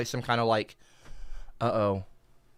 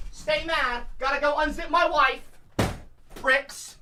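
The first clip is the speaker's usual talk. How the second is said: high smug taunt; 11 semitones higher, slower than usual. Acted. Taunting chat while leaving.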